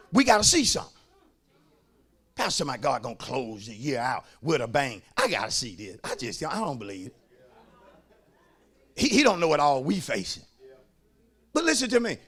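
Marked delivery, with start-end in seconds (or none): 2.4-10.4 very low, soft mock grumble; mock doubter, hushed and deep in pitch; doubting church member. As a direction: imitate a skeptic muttering under the preacher.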